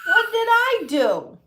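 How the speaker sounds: high, loud drawn-out protest; hammed-up wail of innocence; mock-outraged yell. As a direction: yell it in playful disbelief, stretched out. Mock protest at child's accusation.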